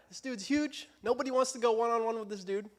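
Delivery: ordinary delivery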